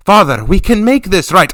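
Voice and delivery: put-on a young woman pressing her plan; pleading daughter. Daughter urges her father to make it right, pleading.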